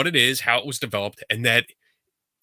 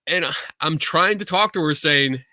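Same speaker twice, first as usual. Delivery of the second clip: normal talking voice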